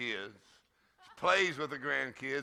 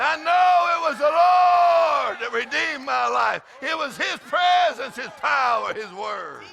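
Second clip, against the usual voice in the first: high shouted praise, falling away at the end; full-force preaching; 10 semitones higher, 12 dB louder than usual. Acted. Preacher modelling praise for the congregation, full-force preaching. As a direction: shout the testimony, then let it drop.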